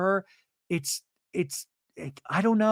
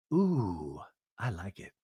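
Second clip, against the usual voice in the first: low, soft, hushed "Ooh, I like it"; breathy Mary impression; 7 semitones lower, 5 dB quieter, slower than usual. Mary tasting beer with Heather, low hushed approval. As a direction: purr the approval, much lower and softer.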